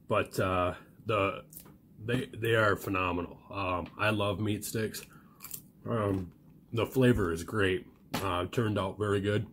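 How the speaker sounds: normal talking voice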